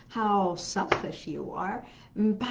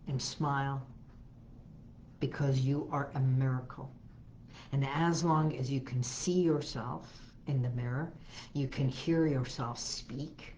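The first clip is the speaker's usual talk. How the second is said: gentle guided affirmation; 4 semitones lower than usual. Acted. Affirmation talk at a mirror.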